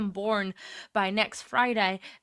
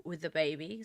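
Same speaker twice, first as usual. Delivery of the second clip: ordinary delivery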